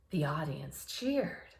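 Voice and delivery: ordinary delivery, nothing marked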